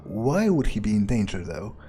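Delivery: ordinary talk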